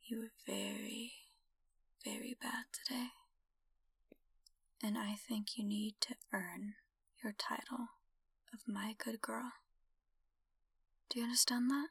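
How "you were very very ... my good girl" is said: dominant whisper. Acted. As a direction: lean in close and whisper the orders. Whispered dominant roleplay opening.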